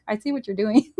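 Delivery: amused, laughing through the words; knowing laugh. Retold or imitated. Telling God they're onto Him, amused and laughing. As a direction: let a chuckle into it, as if catching someone out. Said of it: "I laugh and I go like this".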